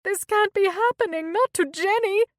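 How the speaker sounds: high, loud anguished wail. A narrator's reading. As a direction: cry out in disbelief. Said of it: "wailed Lourdes".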